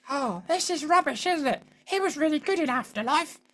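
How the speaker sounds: high-pitched mock wife; wife impression. Wife giving her verdict on the show, imitated in a high voice. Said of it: "She doesn't really speak like that".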